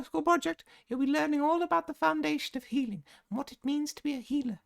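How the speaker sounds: very high, fluting matron voice; gushing healer supervisor. Acted. Madam Strout welcoming students to the hospital, very high gushing voice. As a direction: coo at your little lambs in falsetto.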